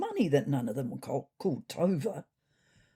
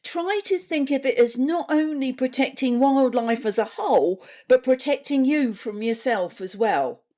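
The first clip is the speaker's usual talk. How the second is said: higher and louder, preachy bureaucrat voice; pompous official briefing; 8 semitones higher, 6 dB louder than usual. Acted. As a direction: lecture the cats like a self-important health official. Susie's briefing continues.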